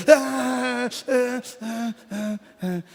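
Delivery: high sobbing gasps that fade away; imitated sobbing gasps. Father hugging his children, imitated sobbing gasps. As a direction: relive the sobs, fading out.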